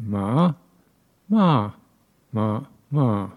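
ma sung through Thai tones. Shown as a demonstration. Teacher demonstrates Thai tones of ma. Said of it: "five tones in it".

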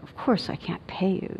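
soft, gently condescending refusal; gentle, superior. Retold or imitated. Entitled passenger refusing the cab driver, gently superior. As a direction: quietly talk down to him, mock-gentle. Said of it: "I was being kind of gentle".

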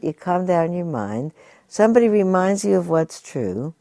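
ordinary talk, nothing marked

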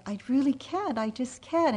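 lightly replaying tearful pleading; retold crying plea. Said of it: "like a puddle on the floor crying".

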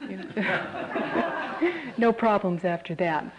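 laughing through the words; amused laughing. Real, not acted. Storyteller to listeners after the monk's enlightenment, amused laughing. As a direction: let a chuckle break into the aside.

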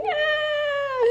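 put-on a high, startled baby wail; child's cry. Mimicking a small child crying out to its mother, high wail. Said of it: "Child is like".

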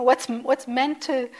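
normal talking voice

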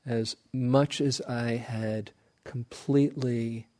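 ordinary talk, nothing marked

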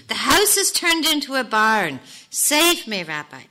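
acted, exasperated appeal; crying out. A narrator's reading. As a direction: beg the rabbi to rescue you. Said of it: "returned to the rabbi and cried".

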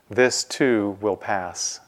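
slow, low, weighty reading of the inscription; slow solemn reading. Shown as a demonstration. Teacher reads the ring's words to the meditators, slowly. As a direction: let each word of the inscription land slowly.

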